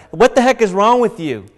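loud mocking yell, drawn out at the end; mocking insults. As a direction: hurl it at him like the scoffing criminal. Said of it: "stuff like".

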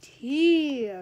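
loud, high call of the word tea; excited call. Real, not acted. Vlogger calls out to viewers to start gossip, loud and excited.

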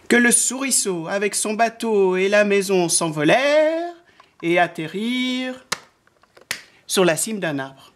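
drawn-out phrases that swoop up as things fly; children's story narration. A narrator's reading. Narrator telling how the wind blew, lilting storytelling. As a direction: tell it with suspense to small children.

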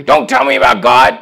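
loud angry shout, imitating the man; retold angry outburst. Preacher retelling a man's rant about Christians, imitated angry shout. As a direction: bark it back the way the angry man did. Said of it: "and he got angry".

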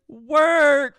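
high, loud cheering cry; mock cheer. Podcaster mimicking fans cheering a coming-out, high loud cheer. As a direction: whoop it like a crowd cheering. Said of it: "everyone will be like".

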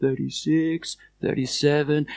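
faint counting from far away; distant voice. Acted. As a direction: count as if far across the water. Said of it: "you can hear this distant voice".

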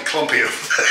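ordinary talk

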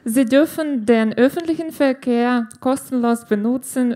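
normal talking voice

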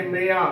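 ਮਿਆ said me-ya, short first vowel; no long aa after the m in ਮਿਆ; correct pronunciation. Shown as a demonstration. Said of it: "ਮੁਕਤਾ ਹੀ ਉਚਾਰਨ ਕਰਨਾ ਹੈ".

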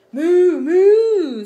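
loud, high, drawn-out mooing; cow moo. Acted. Cow answers kitten with a loud moo. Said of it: "said the cow".

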